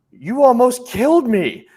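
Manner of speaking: hammed-up outraged customer; indignant woman. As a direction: play the shopper as over-dramatic victim. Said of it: "she goes".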